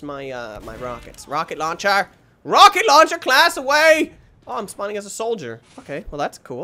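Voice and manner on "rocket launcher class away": loud, high battle announcement; hammy high-pitched rallying cry; mock hero call. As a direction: proclaim it like a superhero launching.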